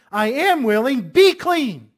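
loud, high exclamation; ringing order with an exclamation point; emphatic command. Acted. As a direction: shout it as a commanding Jesus. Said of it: "Notice the explanation point at the end".

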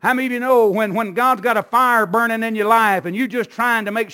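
loud, high, driving sermon; full-force preaching. Acted. Pastor rousing the church about shaking off snakes, full-force preaching. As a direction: preach it hard to the congregation.

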